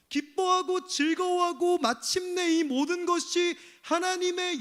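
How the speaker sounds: a touch of shameless defiance; brazen prayer. Retold or imitated. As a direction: argue with God like you're in the right. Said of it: "뻔뻔하게 이야기하는".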